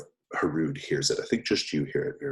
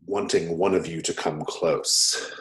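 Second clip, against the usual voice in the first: ordinary delivery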